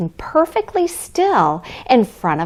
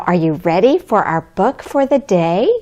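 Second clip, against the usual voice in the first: usual speaking voice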